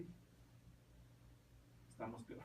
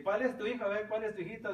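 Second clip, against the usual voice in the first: priest's loud question; 6 semitones higher, 6 dB louder than usual. Retold or imitated. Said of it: "y dice".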